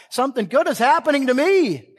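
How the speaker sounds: sudden high, ringing preacher's cry; exultant declaration. Real, not acted. Believer telling himself he is moving forward, exultant declaration. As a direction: burst out in triumph after the build-up.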